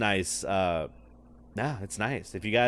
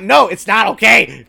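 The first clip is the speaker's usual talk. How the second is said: mock insistence; 9 semitones higher, 13 dB louder than usual. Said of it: "I'm like".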